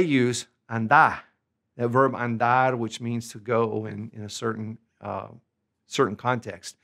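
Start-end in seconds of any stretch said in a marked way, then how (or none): none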